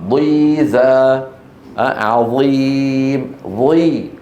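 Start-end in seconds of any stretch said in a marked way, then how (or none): 0.1-1.2 ضيزى with a long, slow, fully stretched final vowel; drawn-out Quranic reading. Shown as a demonstration. Teacher showing his class how ضيزى is read, drawn out. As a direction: stretch ضيزى slowly to show the kasra. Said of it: "مكسور قوي".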